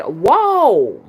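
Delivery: loud, high, drawn-out exclamation; acted-out astonishment; retold alarmed whoa. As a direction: swoop up high and back down in shock. Acts son's alarm at fire.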